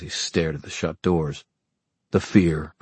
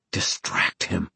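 hushed urgent whisper; 6 dB quieter, slower than usual. Acted. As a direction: breathe the words inward, slowly. Urgent inner thought, whispered.

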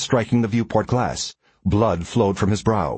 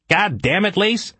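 high, forceful outburst at a colleague; angry yell; 11 semitones higher, slower than usual. A narrator's reading. Ellis berating the pilot after a jolt, angry yell. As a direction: yell it out in anger, pitch leaping up. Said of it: "Ellis yelled".

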